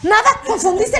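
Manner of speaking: high-pitched, defensive backpedalling; mock innocent protest; flustered denial. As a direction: imitate someone caught out. Actress's flustered denial, acted.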